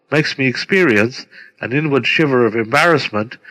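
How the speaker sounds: ordinary talk, nothing marked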